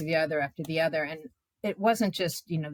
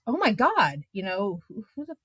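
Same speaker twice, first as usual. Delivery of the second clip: high, stunned outcry; rising shocked exclamation; shocked disbelief; 7 semitones higher than usual. Retold or imitated. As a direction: blurt it out in horror. Shocked outburst at press conference.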